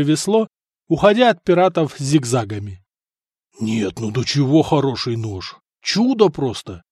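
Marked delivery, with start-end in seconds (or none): none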